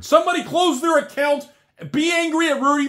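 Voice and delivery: high, loud mock outrage; mock angry shout. Mock rallying viewers against himself.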